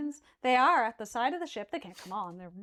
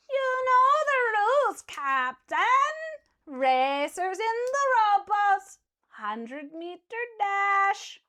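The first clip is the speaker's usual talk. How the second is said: calling up; 9 semitones higher, 5 dB louder, much slower than usual. Acted. Merman calling up to captain.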